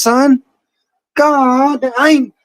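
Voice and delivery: ordinary delivery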